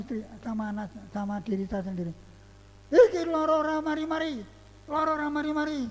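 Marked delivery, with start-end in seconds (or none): 2.9-5.9 higher, acted-out complaint. Preacher acting out a complaint about endless sickness, mock exasperation. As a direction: imitate someone complaining, pitched up.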